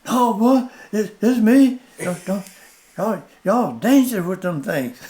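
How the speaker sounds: high, loud, stammered mock alarm; alarmed pleading. Man behind the tree begs slingshot shooters, mock alarm. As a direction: act out a spooked man calling out, half laughing.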